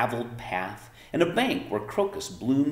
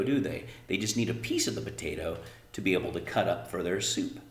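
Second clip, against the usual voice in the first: usual speaking voice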